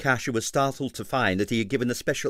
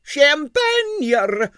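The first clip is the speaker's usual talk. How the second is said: very high, loud ecstatic cry; ecstatic shrill; 6 dB louder than usual. A narrator's reading. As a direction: squeal it with delight. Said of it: "an ecstatic shrill from the door".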